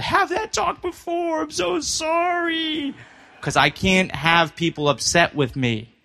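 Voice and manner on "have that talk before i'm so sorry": high, slow pleading falsetto; whiny falsetto. Retold or imitated. To his upset girlfriend before her final, high slow falsetto apology. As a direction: whimper a high sorry like a guilty kid.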